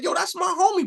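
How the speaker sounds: high-pitched retold excitement; excited recognition. Inmate telling another that's his homie, excited. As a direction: claim your friend eagerly, pitch way up.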